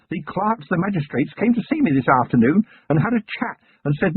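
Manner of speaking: keen country lad proud of his notebook; eager young constable. Acted. Young constable telling his commander, earnest lad voice. Feeney's long eager speech.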